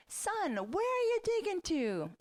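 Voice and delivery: high, slow sing-song call; calling out to son. Retold or imitated. Mom calling out to her son in the hole. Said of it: "I called out to him".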